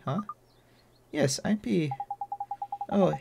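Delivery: normal talking voice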